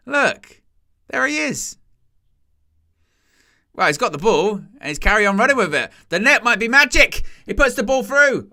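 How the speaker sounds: high, loud, building thrill as he runs with the ball; excited commentary. Real, not acted. Streamer watching the player with the net charge forward, high excited call.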